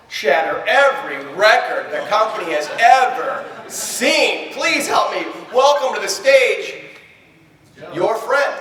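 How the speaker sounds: mock emcee announcement. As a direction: call it out to a cheering hall. Emcee introducing record-breaker on stage.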